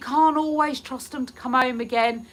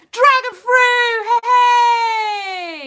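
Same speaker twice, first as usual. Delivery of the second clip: loud, slow, high call of a name; delighted loud welcome for Dragon Fruit, higher and slower; excited shout; 11 semitones higher, 7 dB louder, much slower than usual. Real, not acted. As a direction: shout the greeting across the room. Excitedly greeting a viewer by name.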